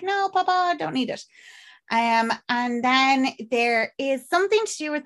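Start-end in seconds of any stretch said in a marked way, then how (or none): 0.0-1.2 dismissive daughter. Retold or imitated. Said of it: "And she's like".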